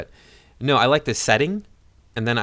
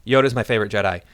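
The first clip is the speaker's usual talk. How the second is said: ordinary talk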